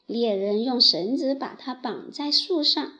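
plain reading voice

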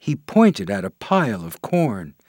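ordinary delivery

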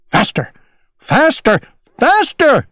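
building from plain to a high cry. A narrator's reading. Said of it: "cried Owl".

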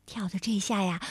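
ordinary delivery, nothing marked

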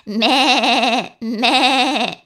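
high, loud, wavering bleat; sheep bleat. A narrator's reading. Sheep calls the spider with loud quavering bleats. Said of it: "bleated the sheep".

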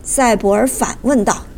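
plain reading voice

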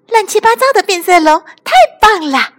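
high, loud, fast cheering shout; the crowd cheers the chameleon; excited shout. A narrator's reading. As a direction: shout it out with glee. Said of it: "笑维猛喊道".

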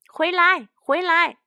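high, loud shout to come back; urgent ringing calls; calling out. A narrator's reading. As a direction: holler after runaways. Calling runaway roosters back.

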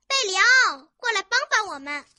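high, loud call for help; loud shout. Acted. Chicks call a friend over to help, shouting loudly. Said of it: "小鸡们大声喊".

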